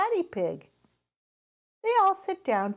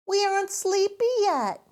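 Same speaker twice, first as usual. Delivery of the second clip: put-on little girl voice; 12 semitones higher, 5 dB louder than usual. A narrator's reading. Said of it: "says Peppa".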